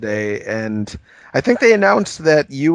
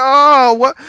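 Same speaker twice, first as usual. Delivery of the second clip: high, loud startled "oh, what"; shocked; 14 semitones higher, 7 dB louder than usual. Retold or imitated. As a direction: blurt it out in shock. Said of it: "And you're like".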